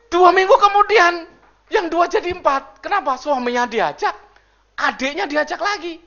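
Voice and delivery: loud, high preaching voice; preaching at full force. Acted. Pastor booming to the congregation, loud and high. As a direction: preach it out to the church.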